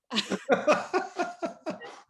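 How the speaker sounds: high, loud peal of laughter; real loud laughter. Real, not acted. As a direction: let the laughter burst out freely. Laughing at having been acting.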